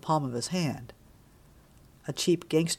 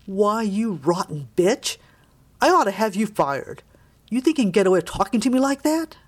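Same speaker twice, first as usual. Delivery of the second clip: loud, outraged tirade climbing in pitch; sputtering with rage; 4 semitones higher, 6 dB louder than usual. A narrator's reading. Outraged father threatening his son's teacher, sputtering with rage. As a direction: rage at them, louder and rising to a high indignant finish. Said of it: "sputtered with rage".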